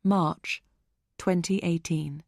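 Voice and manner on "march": ordinary delivery, nothing marked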